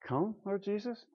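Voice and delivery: unwilling, muted invitation; reluctant, half-hearted. Shown as a demonstration. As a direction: mumble it as if you'd rather not. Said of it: "rather than".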